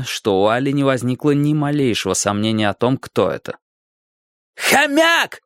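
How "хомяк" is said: very high, narrated yell. A narrator's reading. Alli spotting the boy locking the gate, high yell. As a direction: cry out the name at high pitch. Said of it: "завопила она".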